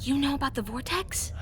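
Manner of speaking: hushed secret whisper. Acted. Janelle asks coworker about the vortex, whispered. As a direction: breathe the question so no one hears. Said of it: "what are you two whispering about".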